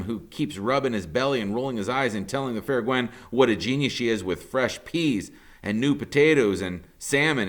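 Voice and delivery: ordinary delivery, nothing marked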